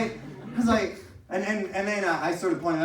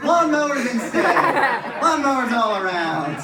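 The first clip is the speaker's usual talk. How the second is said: laughing shout; 9 semitones higher, 8 dB louder than usual. Real, not acted.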